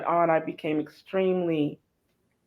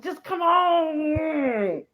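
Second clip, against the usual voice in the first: drawn-out plea; 11 semitones higher, much slower than usual. Retold or imitated. Pleading with Gail.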